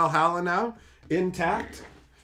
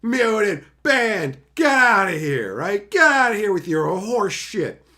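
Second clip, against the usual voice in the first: mock angry ban; 5 semitones higher, 7 dB louder, slower than usual. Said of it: "I'm like".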